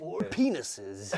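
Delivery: slow, laughing trailer voice; trailer voiceover. Pretend. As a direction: drag it out like a trailer tagline. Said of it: "a movie trailer voiceover".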